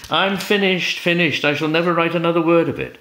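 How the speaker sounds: high, fast theatrical wail; mock wailing. Reader voicing Coleridge stuck on his poem, mock wailing. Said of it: "He was weeping and wailing".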